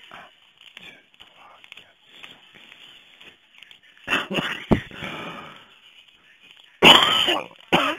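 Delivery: counting the cards in a whisper; murmured count while dealing cards. Real, not acted. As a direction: whisper the numbers under your breath. Counting cards under breath.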